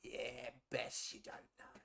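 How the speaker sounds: a shady trader warning under his breath; low secretive dealer voice. Acted.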